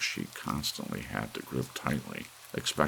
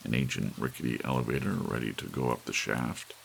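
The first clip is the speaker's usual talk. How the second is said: plain reading voice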